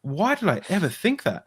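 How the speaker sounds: high-pitched, baffled question; incredulous realization. Retold or imitated. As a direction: question yourself in sudden disbelief. Acts out his sudden realization.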